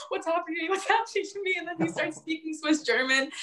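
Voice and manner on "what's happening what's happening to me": high, bewildered grandpa wail; mock stoned panic. High grandpa asking what's happening to him, mock bewildered panic. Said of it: "he's laughing. He's also like".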